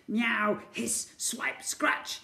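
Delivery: breathy hissing cat noises; attacking cats. Acted. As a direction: snarl like angry cats.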